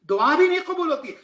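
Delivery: the word بھی hit hard and high; loud emphasis. Real, not acted. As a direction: drive the point home with force. Preacher stresses the point. (زور دار پکار)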